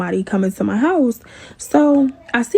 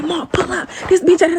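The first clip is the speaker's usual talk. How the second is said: urgent call; 6 semitones higher than usual. Retold or imitated. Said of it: "I'm like".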